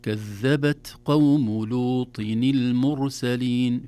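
slow, loud, measured recitation; Quran recitation. Shown as a demonstration. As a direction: recite the verse with drawn-out long vowels. Recites the verse in full. (تلاوة مرتلة متأنية)

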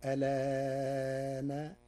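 ألان very slow, the vowel of lan held at medium length; medium lengthening. Shown as a demonstration. Teacher shows the reciter's chosen medium length on ألان. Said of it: "التوسط فيقرأها هكذا".

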